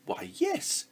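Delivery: high eager exclaim. Acted. Princess answering the helpful frog, eager exclaim. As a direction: blurt it out eagerly. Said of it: "exclaimed the princess".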